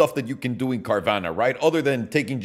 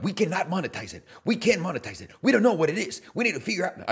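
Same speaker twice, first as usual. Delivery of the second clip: a light, slightly higher take on the film's Zuckerberg; Mark Zuckerberg impression; 5 semitones higher than usual. Quoting the movie's Zuckerberg to his viewers, a light higher imitation. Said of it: "I don't know why Mark Zuckerberg talks like that".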